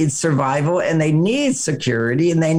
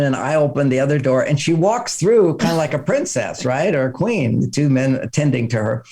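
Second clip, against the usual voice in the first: normal talking voice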